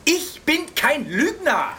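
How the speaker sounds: high, slow, emphatic protest; affronted, drawn-out denial; indignant denial. Acted. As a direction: protest the accusation word by word. Accused of lying, protests.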